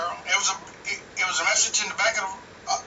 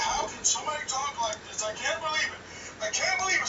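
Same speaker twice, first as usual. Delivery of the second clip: higher-pitched mock outrage; put-on shocked voice, rising higher; mock disbelief; 5 semitones higher than usual. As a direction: imitate scandalized leaders who can't believe it. Mocks shocked GOP leaders.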